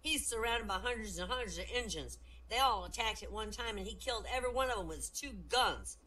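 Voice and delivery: high-pitched schoolboy voice; little Johnny. Acted. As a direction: tell it like an eager little kid. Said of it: "Little Johnny raises his hand".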